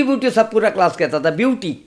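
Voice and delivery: usual speaking voice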